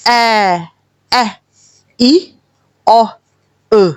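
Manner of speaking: short clipped "اے، ای، او" one by one; short vowel demo. Shown as a demonstration. Teacher sounds out the short vowels. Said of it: "میں سارے بول دیتی ہوں".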